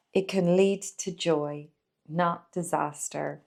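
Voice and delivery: deliberate reading, phrase by phrase, with pauses; emphatic read-aloud. Acted. Presenter reads poem's prescription for fearful people, emphatic read-aloud. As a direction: lean on key words as you read aloud.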